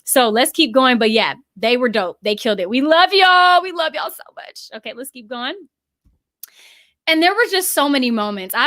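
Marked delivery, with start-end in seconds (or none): 2.7-4.7 joyful shout-out. Real, not acted. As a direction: shout your love to everyone watching. Calling out love to viewers.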